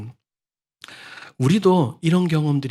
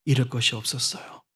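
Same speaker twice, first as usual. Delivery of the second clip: softer, lower, dwelling on 잃을; hushed emphasis; 3 semitones lower, 7 dB quieter than usual. Real, not acted. Preacher softly restating that Bartimaeus had nothing to lose. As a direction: lean gently on 잃을 and let it sink.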